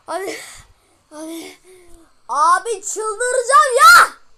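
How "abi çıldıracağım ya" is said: slow, loud exasperated yell; frustrated shout. Real, not acted. Gamer shouting at the game he can't pass, slow furious yell. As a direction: roar out the frustration, dragging it. Gamer losing it over a jump.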